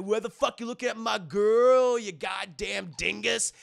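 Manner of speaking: loud, aggressive challenge; hammed-up tough-guy threat; bully picking a fight over his girl; bully snarling. Bully confronting Johnny.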